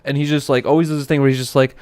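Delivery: usual speaking voice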